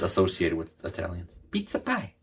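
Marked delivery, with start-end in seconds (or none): 1.5-2.1 mock Italian accent. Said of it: "Did you try an Italian accent there?".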